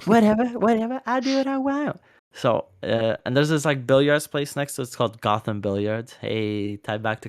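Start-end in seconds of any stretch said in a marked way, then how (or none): 0.1-2.0 very high put-on bratty voice; mock defiance. Host echoes friend's catchphrase to tease, high-pitched mock defiance. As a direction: squeal it out like a defiant brat.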